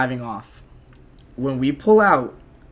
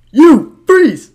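much higher, much louder barked command; cop shout; 12 semitones higher, 13 dB louder than usual. Retold or imitated. Cop shouting at partiers, much higher and louder. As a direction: yell it like a cop with a flashlight.

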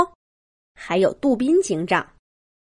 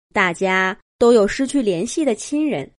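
plain reading voice

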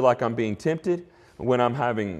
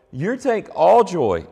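slow and louder, leaning hard on all; stress on all; 4 semitones higher, 4 dB louder, slower than usual. Shown as a demonstration. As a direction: press all home, word by word, slowly. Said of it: "It's emphatic there in the text".

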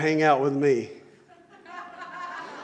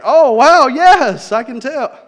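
loud high sarcastic God; 6 semitones higher, 10 dB louder, slower than usual. Retold or imitated. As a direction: play it dry and unimpressed. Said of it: "And God's like".

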